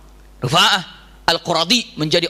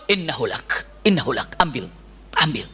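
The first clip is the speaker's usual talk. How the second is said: low, soft permission; calm grant; 5 semitones lower, 4 dB quieter than usual. Retold or imitated. The Prophet quietly hands the boy to the woman. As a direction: give him to her gently.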